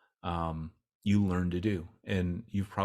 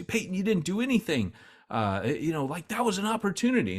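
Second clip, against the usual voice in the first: imitating a much higher, exasperated scold; frustrated scolding; 11 semitones higher than usual. Mission board scolding Peyton after the church visit, mock exasperated. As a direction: play the exasperated boss, mildly hammed. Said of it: "they would be so frustrated".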